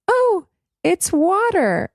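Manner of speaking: put-on high, slow little-girl voice; child voice. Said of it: "sometimes she'll be like".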